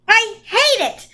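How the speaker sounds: put-on high, slow child's outburst; passionate cry. A narrator's reading. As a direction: cry out hating the dark thing. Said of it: "Charles Wallace cried passionately".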